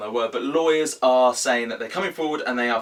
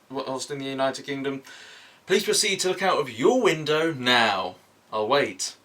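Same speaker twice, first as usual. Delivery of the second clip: hammed-up TV weather read; mock weather presenter; 3 semitones higher than usual. Weatherman Mark presenting the evening weather to viewers, hammed up.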